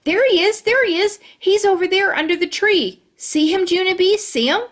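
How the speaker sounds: high, loud excited pointing. Acted. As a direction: bounce with excitement. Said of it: "very excited".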